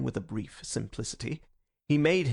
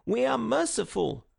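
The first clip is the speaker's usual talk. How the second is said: ordinary delivery, nothing marked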